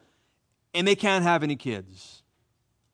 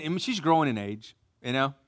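ordinary talk, nothing marked

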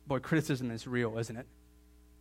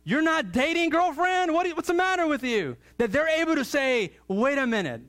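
full-force preaching; 10 semitones higher, 5 dB louder than usual. Real, not acted. Preacher urging families to chase after God, full-force preaching. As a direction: preach it loud and high, stretching key words.

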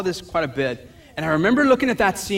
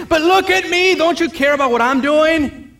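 much higher, louder whining plea; self-pitying whine; 11 semitones higher, 5 dB louder than usual. Retold or imitated. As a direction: whine for attention. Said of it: "she's like".

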